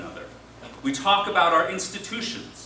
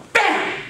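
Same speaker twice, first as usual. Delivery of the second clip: very high, loud "bam"; a sudden explosive hit; mimicked punch impact; 5 dB louder than usual. Chinese guy hits back in a joke, explosive bam.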